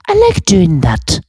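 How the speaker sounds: put-on very high, slow elderly British woman scolding; McGonagall voice. Keeps the old-lady voice.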